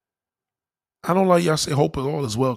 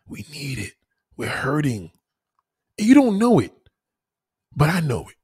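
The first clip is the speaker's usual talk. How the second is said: heartfelt sermon; 3 semitones lower than usual. Acted. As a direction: lift them up like a Sunday sermon. Preaching about human need.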